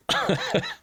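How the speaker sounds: a quick self-mocking laugh; short laugh. Real, not acted. Admitting the plan failed, short self-mocking laugh. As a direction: laugh at your own mistake. Laughs after "not how that works.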